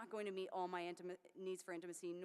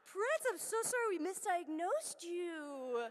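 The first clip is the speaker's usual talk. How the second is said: much higher, slow and a bit louder pet voice; baby talk apology; 8 semitones higher, 7 dB louder, slower than usual. Retold or imitated. Apologizing to Prince in slow, high baby talk.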